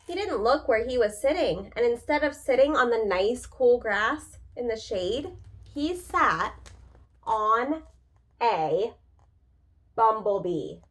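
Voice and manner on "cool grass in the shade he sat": usual speaking voice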